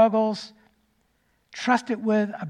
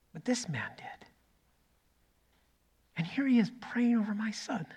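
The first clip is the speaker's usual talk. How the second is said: hushed whisper; 9 dB quieter than usual. Real, not acted. Moved by the man praying.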